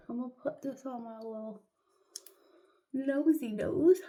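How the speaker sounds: put-on baby voice for a pore strip on the nose; cutesy baby talk. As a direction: coo it like talking to a baby.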